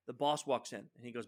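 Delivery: ordinary talk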